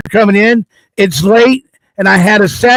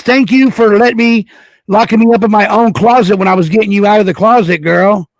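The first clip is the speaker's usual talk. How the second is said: usual speaking voice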